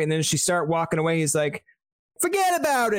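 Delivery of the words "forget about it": high mock New York. Retold New York tourist scene.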